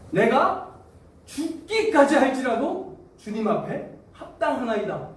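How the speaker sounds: high, loud, slow defiant declaration with a laugh; defiant retort. Acted. Jonah talking back to God, high defiant outburst. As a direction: talk back boldly. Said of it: "이렇게 들이댔어요".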